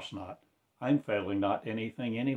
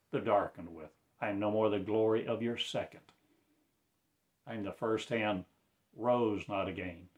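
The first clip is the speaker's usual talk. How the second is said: ordinary delivery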